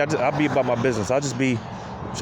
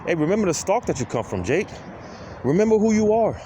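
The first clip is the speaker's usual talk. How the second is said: mirror pep talk; 7 semitones higher than usual. Retold or imitated. Talking to himself in the mirror, hyped pep talk. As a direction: pump yourself up in the mirror.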